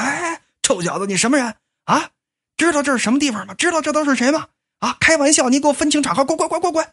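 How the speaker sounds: snooty guards chasing off a nobody; bossy bodyguards. Acted. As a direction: act the bouncer barking him away. Bodyguards chase the stranger away.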